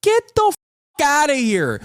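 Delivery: sharp high yell, real anger; angry outburst. Real, not acted. Ranting at Republican critics of Crockett, angry yell. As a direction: fling it at them, fed up.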